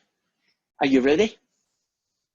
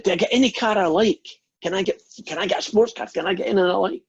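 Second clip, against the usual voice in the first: eager, higher-pitched Scotsman; acted eagerness, pitched up; excited; 6 semitones higher, faster than usual. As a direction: pile up questions like an excited kid. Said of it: "Scotsman gets dead excited".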